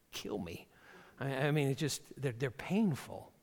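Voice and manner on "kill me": breathy whisper of kill me; deadpan whispered aside; whispered punchline. Acted. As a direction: drop to a dramatic whisper. Punchline after proverb.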